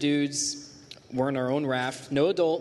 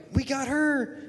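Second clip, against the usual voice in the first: high, excited retold cheer; 9 semitones higher than usual. Boy cheering out the bus window, excited. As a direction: cheer it out like you won.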